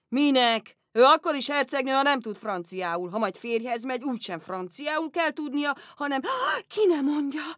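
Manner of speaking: loud, booming father; a hearty yell to call the girl away; father shouting. Retold or imitated. Father interrupts French lesson, booming and loud. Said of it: "Mire anya".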